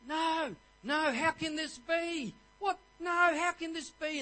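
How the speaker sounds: high, drawn-out panicked cries of no; panicked screaming. A narrator's reading. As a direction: play the monk in horror at what he reads. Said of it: "they're screaming".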